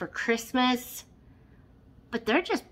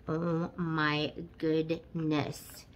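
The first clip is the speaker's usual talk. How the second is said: ordinary delivery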